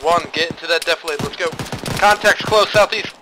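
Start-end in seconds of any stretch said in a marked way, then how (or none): 2.0-3.1 high loud contact call; shouted callout. Real, not acted. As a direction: yell the warning to the squad. Calling out enemy contact.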